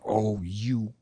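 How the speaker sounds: low, drawn-out looming syllables; slow, heavy, understated menace; looming, mildly angry. Shown as a demonstration. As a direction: loom over each long syllable, quietly threatening. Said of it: "make it sound looming and angry".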